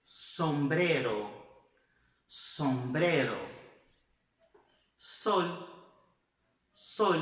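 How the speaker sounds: "Sombrero" slow, with an S behind the teeth; "sol" with a clear S, tongue behind the teeth; Spanish S. Shown as a demonstration. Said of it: "os espanhóis falam com a língua de trás dos dentes".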